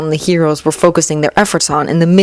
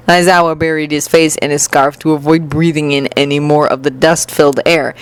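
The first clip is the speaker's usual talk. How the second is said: plain reading voice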